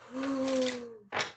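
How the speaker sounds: long, high-pitched sigh; drawn-out high exhale. Real, not acted. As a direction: let out a long high "ooh" of breath. Long high exhale between remarks.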